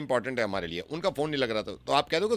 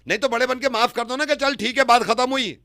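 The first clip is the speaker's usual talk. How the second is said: high-pitched, insistent protest; heated pleading; 11 semitones higher, 4 dB louder than usual. Pretend. Caller begging the other man to forgive, heated pleading. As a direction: plead with rising exasperation.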